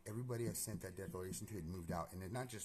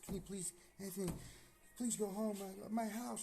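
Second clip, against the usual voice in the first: very high-pitched mock-tearful begging; high, pleading imitation of crying; imitated crying plea; 14 semitones higher, 4 dB louder than usual. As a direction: imitate her pleading through tears. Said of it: "starts crying".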